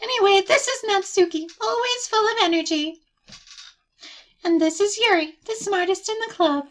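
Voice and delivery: high, put-on introducing voice; cheerful girlish friend. Sayori introduces club members to Moop, high and cheerful.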